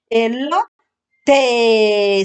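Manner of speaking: "El lo, te" slow, separate and held; slow phonics reading; sounding out syllables. Shown as a demonstration. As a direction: draw out each piece of elote. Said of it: "sí vino la vocal".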